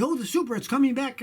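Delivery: mock gushing fan, lifted pitch; sarcastic imitation of hyped fans; mocking hype. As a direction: parrot the excited crowd, mockingly. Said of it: "but everybody".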